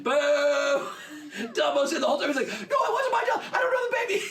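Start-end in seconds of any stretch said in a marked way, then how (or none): 0.0-0.9 high, loud crowd boo; louder booing. Retold or imitated. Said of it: "it gets louder each time". 2.5-4.2 very high, hammed-up protest; mortified pleading. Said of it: "He's so mortified".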